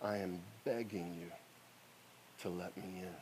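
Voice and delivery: low, hushed plea; humble begging. Retold or imitated. Preacher kneeling before customs lady, hushed plea. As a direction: beg softly from your knees. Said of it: "and I said this".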